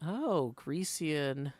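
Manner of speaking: usual speaking voice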